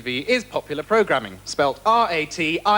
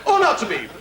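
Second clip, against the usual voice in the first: Bob Monkhouse Shakespeare; 9 semitones higher than usual. Retold or imitated. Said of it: "rely on Bob Monkhouse".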